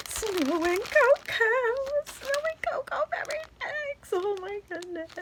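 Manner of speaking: excited big reveal; thrilled reveal. Real, not acted. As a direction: announce the prize with delight. Reaching the main gift.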